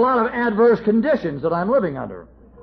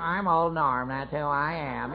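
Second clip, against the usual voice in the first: softer, slower sneering echo of his boast; mocking mimicry; 4 semitones lower, 5 dB quieter, slower than usual. Wife mimicking her drunk husband's boast, mockingly. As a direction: parrot his words back to mock him. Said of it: "how they mimic it".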